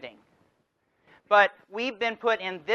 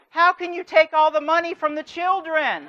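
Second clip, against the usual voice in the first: higher, louder mock outrage; hammed-up indignant accusation, raised; pissed off; 8 semitones higher, 5 dB louder than usual. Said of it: "And you're pissed".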